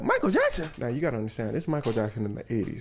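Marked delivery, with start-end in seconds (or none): 0.0-0.7 high-pitched starstruck exclamation; mock amazement. Storyteller as gangsters hearing who wants them, high mock amazement. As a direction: exclaim the star's name way up high. Gangsters react to the star's name.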